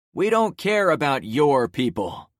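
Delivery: called out. Acted. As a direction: shout it from the back. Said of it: "another voice called out".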